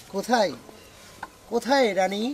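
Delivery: slow, pleading starving man; stage lament. Acted. Actor rehearses hungry lament.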